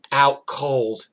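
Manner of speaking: ordinary talk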